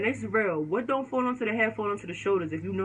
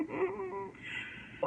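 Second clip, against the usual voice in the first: fake mm-hmm; 11 semitones higher, 11 dB quieter than usual. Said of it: "fake, mm-hmm".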